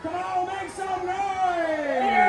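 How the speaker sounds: very drawn-out arena call; crowd hype shout. Real, not acted. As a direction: rouse the crowd, stretching out the final word. Whipping up the crowd.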